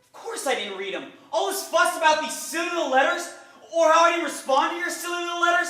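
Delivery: high, loud exasperation; scornful outburst. Acted. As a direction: belittle the complaint at full voice. Acted quarrel over letters.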